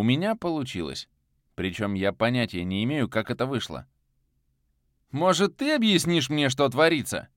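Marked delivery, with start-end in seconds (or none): 5.1-7.3 high, raised angry demand; outburst. Acted. The bound hero snaps back at Viktor, raised voice. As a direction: snap back with heat. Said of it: "Я тоже умел взрываться".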